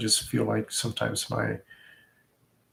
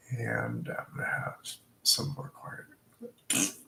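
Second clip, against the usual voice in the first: hushed; 9 dB quieter, slower than usual. Acted. Hushed promise to herself.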